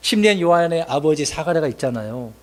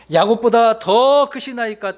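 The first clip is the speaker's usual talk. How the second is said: high, slow skeptical question; mock doubting question; 6 semitones higher, 3 dB louder, slower than usual. Samaritan woman doubting Jesus, slow and high. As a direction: stretch it out high in disbelief. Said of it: "여자는 주님에게".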